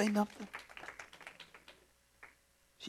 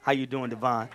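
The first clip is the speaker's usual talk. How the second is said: ordinary talk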